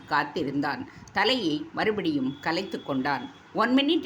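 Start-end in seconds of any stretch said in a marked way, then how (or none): 3.5-4.0 high, sweet call from inside; flute-like voice. A narrator's reading. Girl calls from inside to the waiting suitor, high and flute-like. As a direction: sing it out like a flute. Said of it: "புல்லாங்குழல் ஒலித்தது".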